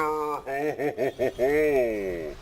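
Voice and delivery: hearty caster laugh. Real, not acted.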